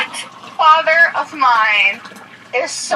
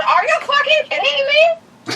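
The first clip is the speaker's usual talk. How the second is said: high-pitched incredulous fury; outraged; 11 semitones higher, slower than usual. Real, not acted. Daughter putting dad in the doghouse, outraged. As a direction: pitch leaps up in real outrage. Daughter angrily confronting her father.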